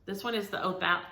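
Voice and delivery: normal talking voice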